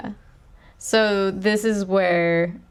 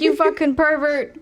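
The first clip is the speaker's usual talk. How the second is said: mock angry detective; 8 semitones higher than usual. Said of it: "he's like".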